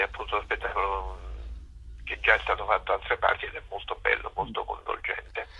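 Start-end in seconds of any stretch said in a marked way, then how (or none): none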